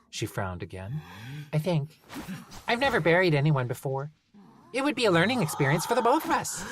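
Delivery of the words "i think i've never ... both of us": high, cheerfully menacing gnome. Acted. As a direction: sweetly threaten to bury him. Gnome musing.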